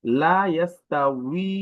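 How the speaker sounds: লা ইয়াস্তাউই slow, drawing out the final wee; correct w sound. Shown as a demonstration. Teacher quotes a Quran example, careful w. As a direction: stretch লা and the last উই of ইয়াস্তাউই. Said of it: "সঠিক উচ্চারণ হচ্ছে উই".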